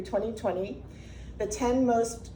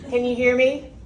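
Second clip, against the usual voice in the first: loud, sharp voice checking the mic; loud projecting; 2 semitones higher, 8 dB louder than usual. Real, not acted. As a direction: call it out loud to the room. Checking mic, calling to room.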